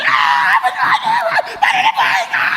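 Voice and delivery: a cry of ah, then a high, strained wail; hopeless wailing. Retold or imitated. A mourner wailing over the dead, hopeless cry. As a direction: wail like a mourner with no hope left. Mourner grieving without hope.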